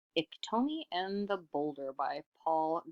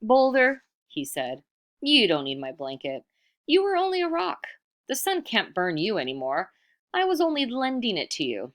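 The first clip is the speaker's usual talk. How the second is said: loud and high, dismissive; rude demand; 6 semitones higher, 5 dB louder than usual. Acted. Iktomi rudely takes back his gift from the boulder, loud. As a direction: brush off the rock's claim.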